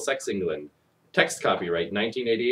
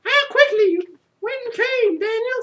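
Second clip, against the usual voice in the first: much higher falsetto mother voice; mother's voice; 5 dB louder than usual. Acted. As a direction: squeak it out as a mock mother, high. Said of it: "said his mother".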